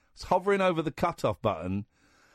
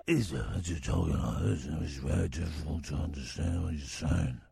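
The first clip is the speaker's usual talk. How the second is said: much lower, growly low mock Batman; 5 dB quieter than usual. Shown as a demonstration. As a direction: drop the voice low to mimic the caller. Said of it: "a very good Batman impression".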